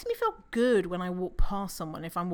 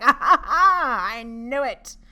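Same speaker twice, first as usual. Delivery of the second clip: loud, higher, slow "aha" laugh; mock triumphant gotcha; 4 semitones higher, 10 dB louder, slower than usual. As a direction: crow as if catching them out. Acts eavesdropper's triumphant gotcha.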